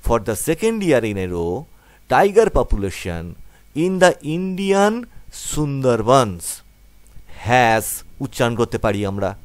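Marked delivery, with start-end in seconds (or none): 0.1-7.8 each word drawn out very slowly; slow reading aloud. Shown as a demonstration. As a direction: read the sentence word by word for learners. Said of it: "তাহলে কি হলো লাইনটা?". (ধীরে ধীরে line পড়া)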